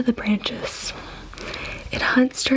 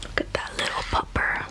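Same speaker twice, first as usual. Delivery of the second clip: cooing whisper; 7 dB quieter, faster than usual. Real, not acted. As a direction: coo it like to a puppy. Cooing at wolf pup.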